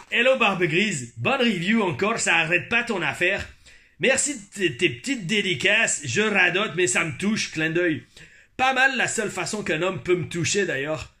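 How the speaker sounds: emphatic, put-on Québécois reading; Quebec accent. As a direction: read it out in lively Quebec French. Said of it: "notez mon accent québécois". (accent québécois appuyé)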